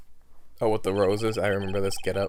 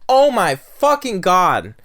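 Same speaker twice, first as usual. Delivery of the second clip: exasperated; 6 semitones higher, 8 dB louder, slower than usual. Real, not acted. Frustrated at losing.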